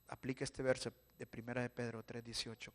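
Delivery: ordinary delivery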